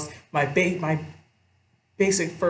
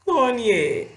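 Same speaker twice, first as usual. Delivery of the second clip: [name] in a put-on foreign accent, a bit louder; joking foreign [name]; mock foreign accent; 6 semitones higher, 4 dB louder than usual. Shown as a demonstration. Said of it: "I should say it with an accent".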